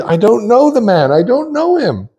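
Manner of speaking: high-pitched, protesting denial; acted-out protest of innocence; vehement denial. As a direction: deny it insistently, voice jumping up high. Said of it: "And he denied it".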